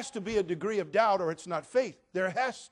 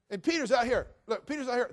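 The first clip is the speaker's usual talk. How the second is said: ordinary talk, nothing marked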